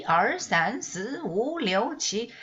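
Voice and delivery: 二 三 四 五 六 七 said with English list tones; teacher showing a mistaken English-style count; wrong tones. Shown as a demonstration. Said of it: "You cannot say".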